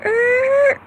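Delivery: very high, loud squeak; squeaky er. Real, not acted. Mock take-back.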